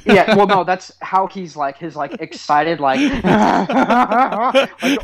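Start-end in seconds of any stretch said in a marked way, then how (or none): 3.0-5.0 cackling. Retold or imitated. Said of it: "like, cackling".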